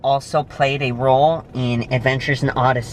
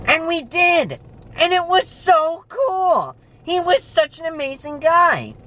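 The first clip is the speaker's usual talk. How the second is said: excited. Real, not acted. Recalls praying with director.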